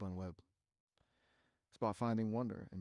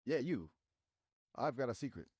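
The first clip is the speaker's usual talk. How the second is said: ordinary talk, nothing marked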